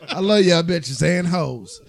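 loud, drawn-out words through laughter; laughing affection. Real, not acted. Joking with the ladies after calling them names, laughing.

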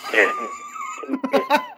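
shrieking laugh at the dropped call. Real, not acted. Host cracks up at bad line.

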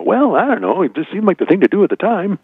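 put-on high, sheepish old-timer shrug; sheepish excuse. Imagined future grandpa excuse.

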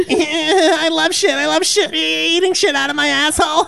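very high, loud, laughing cartoon voice; gleefully gross cartoon sidekick; squeaky giggling cartoon voice; cartoon dog.

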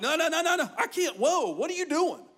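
loud, high flustered refusal; imitating shocked disbelief; freaked-out waiter. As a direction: blurt it out in stunned protest. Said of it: "he freaked out".

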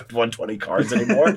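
laughing in disbelief at the idea; mock someone scoffing through a laugh. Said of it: "They're like".

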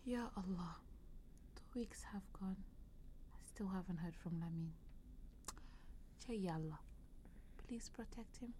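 barely a breath, sick and worried; weak whisper. Acted. Sick mother alone worrying about her son, a weak whisper. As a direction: whisper it, worn out by illness.

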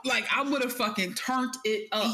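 ordinary talk

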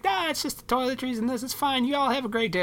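very high-pitched sweet lady voice; super-sweet ladies. Retold or imitated. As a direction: imitate kindly ladies, high and sugary. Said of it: "they were super sweet about it".

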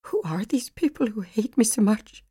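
whispered, lightly sobbing plea; a hushed, tearful question, barely voiced. A narrator's reading. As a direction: Megan breaking down on the phone to her sister, whispered and tearful. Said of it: "Megan had sobbed on the phone".

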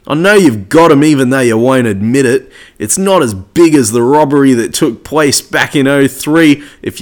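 loud, broad Aussie bogan; full-on bogan rant, loud and punchy; bogan accent. Acted. Reading fans' photo comments aloud in true bogan style. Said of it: "read it out to you in true Bogan style".

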